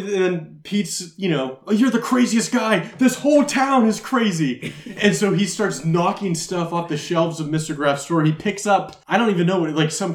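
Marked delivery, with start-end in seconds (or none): 1.7-4.7 high-pitched mock exasperation; exasperated. Robber to the shopkeeper who won't be robbed, exasperated. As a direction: protest as if everyone has lost their mind.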